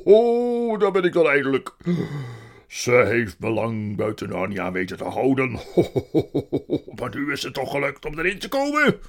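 loud booming jolly voice; a big jolly man announcing his arrival; booming kerstman; jolly Father Christmas. Acted.

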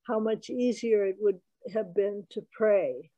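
ordinary talk

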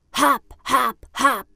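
loud hammed-up monster chomps; little brother playing monster. Little brother Kees pretends to be a monster at the table, loud playful monster voice. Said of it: "die een monster nadoet".

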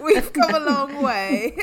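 laughing through the words; slow and laughing. Real, not acted. Laughing at own joke.

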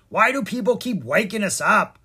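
high, loud indignant squawk; squawking frog. A narrator's reading. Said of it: "another frog squawked".

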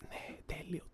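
hushed, whispered approval; whispered delight. Retold or imitated. Waiter enjoying handing drinks to customers, whispered delight. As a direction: whisper it as if savouring the moment. Savouring serving drinks, acted.

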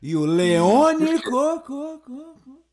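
high echoing repeats; echo effect. Pretend. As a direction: echo the last syllable, fading out. Echo gag on team names.

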